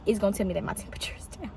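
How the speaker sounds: quick whispered aside. Real, not acted. Aside about camera lighting.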